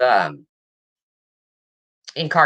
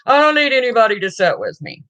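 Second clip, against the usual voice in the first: lightly voiced protest, higher and quicker; imitating Carl; 6 semitones higher, faster than usual. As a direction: imitate a man calling from the background. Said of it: "You can hear Carl in the background saying".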